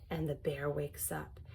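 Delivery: low, soft suspense; hushed. A narrator's reading. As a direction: drop to a hush. Dramatic reveal, low and soft.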